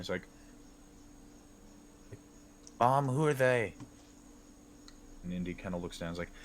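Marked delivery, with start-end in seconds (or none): 2.8-3.7 put-on child voice. Child asks about newcomers.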